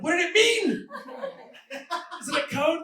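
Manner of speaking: high, loud anguished shout; panicked waking cry. Acted. Officer waking in a cold sweat over shredded evidence, panicked shout. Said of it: "wake up in a cold sweat".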